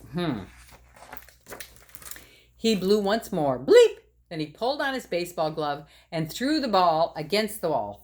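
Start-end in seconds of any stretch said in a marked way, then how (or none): 3.6-4.0 high loud bleep. Acted. Saxophone noise.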